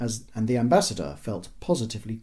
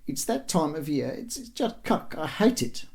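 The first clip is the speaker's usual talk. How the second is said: high, halting, upset voice; mournful wife; 6 semitones higher than usual. A narrator's reading. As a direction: complain miserably in a high, faltering voice. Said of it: "She shook her head mournfully".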